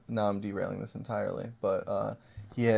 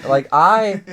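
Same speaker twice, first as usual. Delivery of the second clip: outraged; 6 semitones higher, 11 dB louder, slower than usual. Retold or imitated. Mock outraged self-defense.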